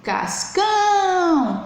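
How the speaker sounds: "Cascão" with CÃO long and high; calling the stressed syllable. Shown as a demonstration. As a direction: call out "Cascão", dragging the last syllable. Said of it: "é aquela que eu chamo". (chamando "Cascão", esticando bem o CÃO)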